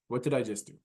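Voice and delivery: ordinary delivery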